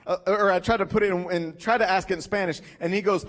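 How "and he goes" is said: ordinary delivery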